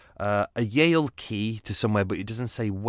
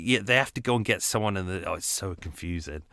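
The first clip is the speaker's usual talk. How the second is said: ordinary delivery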